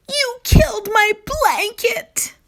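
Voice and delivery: loud, high accusing scream; child screaming at her brother. A narrator's reading. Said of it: "Taylor screamed louder".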